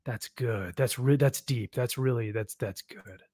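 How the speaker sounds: mock pompous writer murmuring approval; smug murmur. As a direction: purr it as if admiring your own writing. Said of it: "being like".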